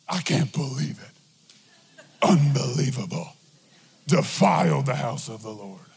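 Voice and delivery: deep, slow, droning church-lady scold, hammed up; mock the prudish churchgoer in a low slow drone; mock pious voice. Pastor mocking religious critics in a deep scandalized drone.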